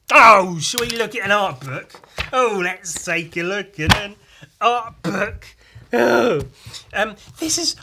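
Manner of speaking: high, loud mock excitement; bright hammed-up eager announcement; excited. Mock posh excited start.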